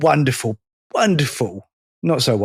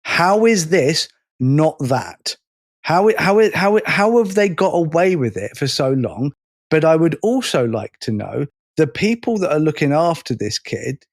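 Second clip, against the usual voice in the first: ordinary talk, nothing marked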